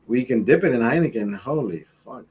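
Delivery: usual speaking voice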